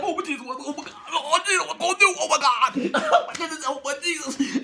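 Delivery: high, rising frightened cries; panicked praying. Retold or imitated. Terrified passenger praying aloud. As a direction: beg God in a panic. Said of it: "Mister Wilson is like".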